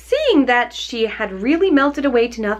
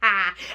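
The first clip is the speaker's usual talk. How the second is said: short gloating witch laugh; witch's mocking cackle. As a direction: cackle at the girl. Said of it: "laughing at her".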